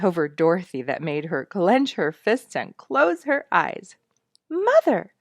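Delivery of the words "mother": high, loud excited call; crying out. Acted. Girl rushing in the door calling her mother, excited. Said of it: "cried Sonya".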